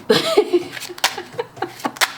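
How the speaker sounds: high breathless giggling fit; giggling at herself mid-reading; real giggle. Real, not acted. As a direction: break into a helpless high giggle. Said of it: "I don't know why I'm laughing".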